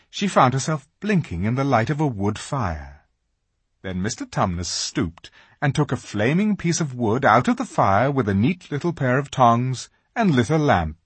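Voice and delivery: usual speaking voice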